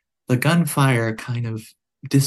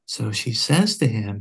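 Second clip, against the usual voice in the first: usual speaking voice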